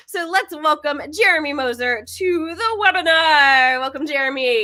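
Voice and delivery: high, building announcer-style welcome; guest introduction. Acted. Host introducing the guest to the webinar audience, announcer-style.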